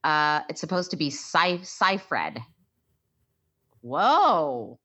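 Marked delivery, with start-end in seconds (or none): 0.0-2.4 Seyfried said SY-fred, long I. Shown as a demonstration. Revealing the actress's real name, SY-fred. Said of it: "I've always said Seyfried".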